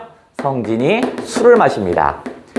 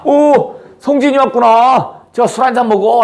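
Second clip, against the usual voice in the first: put-on drunk dragon king; 7 semitones higher than usual. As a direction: hail him like a merry drunk host. Drunk dragon king greets Seongjin.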